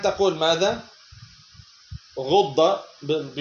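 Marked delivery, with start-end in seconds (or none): 2.2-2.8 "غض" slowly, doubled ḍ ending in short a; final fatha. Shown as a demonstration. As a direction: end "غض" on an -a vowel, ghudda. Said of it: "بفتح الضاد المشددة". ("غض" بفتح الضاد المشددة)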